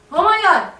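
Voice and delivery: high, loud, gushing alarm at a cat; mock excited owner. Owner hyping the dog at a cat, mock excited. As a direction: hype the dog up like a silly owner. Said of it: "Don't do that".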